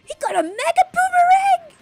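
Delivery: cheering a big reveal at full voice; excited shout. Real, not acted. Fan yelling at the screen, thrilled shout.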